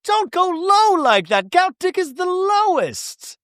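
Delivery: very high, slow mock wail; hammed-up shrieky protest; mocking imitation. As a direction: stretch it out, way up high. Imitating Bronwyn in quarrel.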